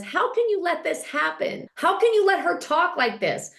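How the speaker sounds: high-pitched mock outrage; indignant appeal. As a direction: plead in protest, imitating an upset woman. Acted appeal in retold quarrel.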